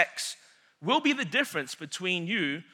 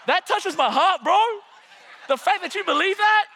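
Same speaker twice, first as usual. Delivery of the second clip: laughing delightedly through the words, high and louder; 12 semitones higher, 6 dB louder, faster than usual. Real, not acted. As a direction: laugh as the crowd's belief tickles you. Crowd believes his rap claim.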